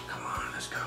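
whispered pep talk; whispering. Real, not acted. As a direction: whisper it to yourself. Psyching up before a bite.

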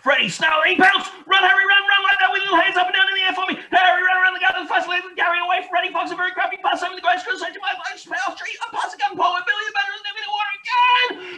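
very high, loud, fast shouting; frantic chase. Acted. Leading the children running from the fox, frantic shouts. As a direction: yell it like a wild chase.

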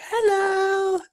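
high-pitched drawn-out critter greeting; funny creature's hello. Acted. Funny little creature greeting a passer-by, high squeaky voice. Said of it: "you hear him say".

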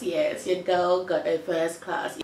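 ordinary talk, nothing marked

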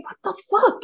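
a stunned "what the fuck", high and airy; high incredulous outcry. Retold or imitated. Retelling her shock at a friend pumping in the room, high incredulous. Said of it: "pumping in the writers' room".

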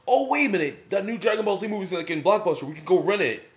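a mom springing a bright idea; mom's excited idea. Retold or imitated. Mom offering to rent the movie, excited and quick. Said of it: "or she'll go".

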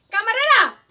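put-on loud drunken shout; drunk yelling. Drunk man shouting for the waitress again, loud. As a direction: call out across the bar.

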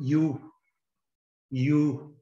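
"Iu" with the U held a little longer; English letter U as a lengthened "Iu"; long vowel. Shown as a demonstration. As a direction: stretch the vowel of "Iu". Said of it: "é uma vogal mais comprida um pouco".

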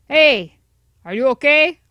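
loud worried call. Pretend. Circus brother shouting to the fallen hero, loud. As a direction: shout across to check on him.

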